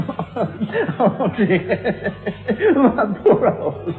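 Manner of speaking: laughing through the words; breaking up with laughter. Real, not acted. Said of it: "laughing at own act".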